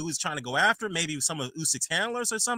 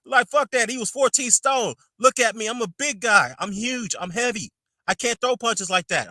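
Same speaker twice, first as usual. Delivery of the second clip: imitating Joshua; 6 semitones higher than usual. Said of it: "he goes on to say that".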